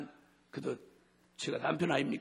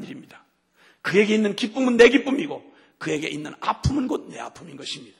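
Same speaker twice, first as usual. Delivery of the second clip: much higher, much louder fervent preaching; full-force preaching; 4 semitones higher, 11 dB louder than usual. Acted. Pastor urging congregation to love God, fervent preaching. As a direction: preach it out, building with passion.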